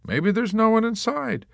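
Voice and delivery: high, airy helpful tone; helpful suggestion. A narrator's reading. Dunbar helpfully teasing the nurse, airy. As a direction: offer it innocently. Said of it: "Dunbar suggested helpfully".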